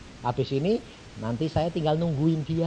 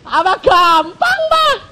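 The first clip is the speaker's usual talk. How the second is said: loud slow challenge; 12 dB louder, slower than usual. Acted. Mocking challenge to listener.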